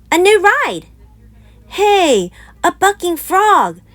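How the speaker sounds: put-on high, loud kid yelling; excited shout. A narrator's reading. Brother shouting to Papa about the bucking frog. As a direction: call out like a thrilled little cub. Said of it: "shouted Brother".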